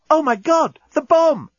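high, loud sudden panic; alarmed. Acted. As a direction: blurt it in sudden horror. Sudden realisation of bomb.

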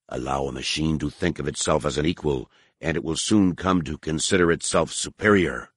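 low, cold and scornful; contemptuous alien priest. Acted. Harar scorns the droids.

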